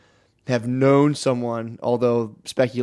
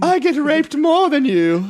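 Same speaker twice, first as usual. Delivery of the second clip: much higher, slow, hammed-up; one-up the suffering pitched up; mocking high voice; 14 semitones higher, 4 dB louder, slower than usual. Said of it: "I have been followed home".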